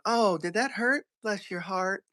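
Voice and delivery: high, soft fake concern; mock sympathy. Imitating Southern lady standing over someone who fell, falsely sympathetic. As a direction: coo it with put-on pity.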